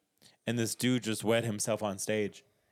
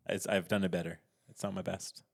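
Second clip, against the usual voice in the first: usual speaking voice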